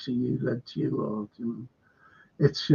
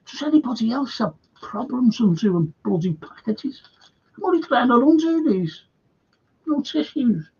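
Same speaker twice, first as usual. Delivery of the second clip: high, strained grumble; fussing in a raised pitch; exasperated; 8 semitones higher than usual. Real, not acted. Host grumbles while trying to undo a bloody package, exasperated. Struggling to open a package mid-talk.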